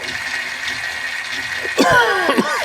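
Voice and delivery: a dry, rasping fit of coughing; real cough. Real, not acted. Sick reviewer breaks off his toy talk with a cough. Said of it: "If I wasn't coughing".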